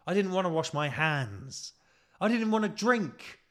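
indignant. Real, not acted. Building indignant list.

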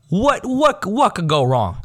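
mock optimism. Acting his overconfident inner voice.

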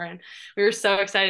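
ordinary talk

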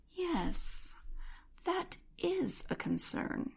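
high, soft, slow cat voice; calm, grave cat, high and hushed; talking cat. A narrator's reading.

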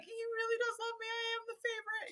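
high, fast, delighted squeal; a breathless mock-gloat; giddy inner voice. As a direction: gush like the favorite child. Inner thought, delighted to be loved.

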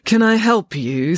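drawn-out, grudging offer of help; reluctant customer service with a surly edge. A narrator's reading. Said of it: "surly woman".

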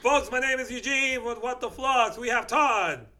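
high, loud show host announcement; announcer voice. Acted. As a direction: sign off like a radio host.

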